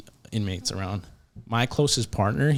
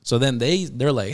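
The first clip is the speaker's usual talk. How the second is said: ordinary talk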